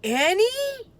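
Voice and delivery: high loud anxious call; alarmed call. A narrator's reading. Jack calling for Annie in alarm.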